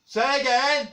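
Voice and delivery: shouting. Acted. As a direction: bellow like you're half deaf. Can't hear, shouting.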